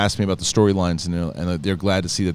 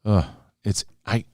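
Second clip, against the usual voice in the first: ordinary talk, nothing marked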